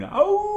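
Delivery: high loud howl at the moon. Acted. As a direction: howl like a wild thing. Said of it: "He's a good howler".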